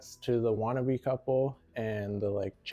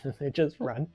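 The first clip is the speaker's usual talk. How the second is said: ordinary delivery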